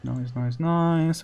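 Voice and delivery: ordinary talk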